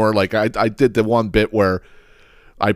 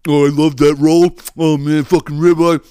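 loud, put-on rapturous food praise; mock reviewer gushing; 4 semitones higher, 4 dB louder than usual. Podcaster parodying cheesesteak reviewers, loud hammed-up gushing. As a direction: gush over every ingredient in parody.

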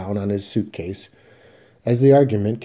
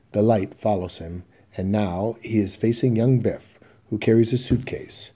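ordinary talk, nothing marked

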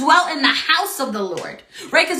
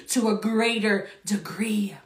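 hushed whisper; 4 semitones lower, 6 dB quieter than usual. Real, not acted. Preacher whispering the promise to the congregation.

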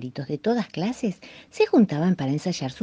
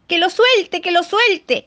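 high, loud chanted demand; all shouting; 12 semitones higher, 7 dB louder, slower than usual. A narrator's reading. All the birds still yelling at the eaglet, loud chant. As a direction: keep yelling it together. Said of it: "seguían gritando todos".

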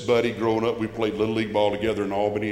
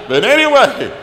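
laughing; 11 semitones higher, 11 dB louder than usual. Real, not acted. Laughs at his own aside.

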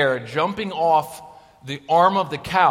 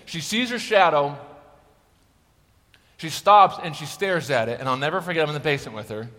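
ordinary delivery

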